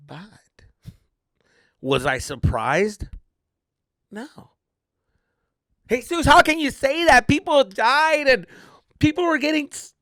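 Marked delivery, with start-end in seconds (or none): 5.9-9.9 high, loud imitation of outraged audience; mock outrage. Imagined audience scolding the comic, high mock outrage.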